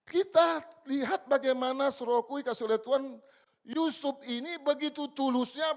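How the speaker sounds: loud, high, fervent preaching to the church; preaching at full force. Acted. Preacher exhorting the church about obedient Yusuf, loud and fervent. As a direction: hammer each phrase at the congregation.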